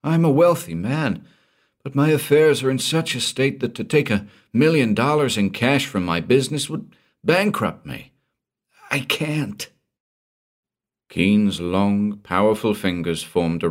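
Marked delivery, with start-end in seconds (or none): 0.0-9.7 much higher, anxious explaining; frightened Walstead. Acted. Frightened man explaining his ruin, anxious and high. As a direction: plead your case in distress.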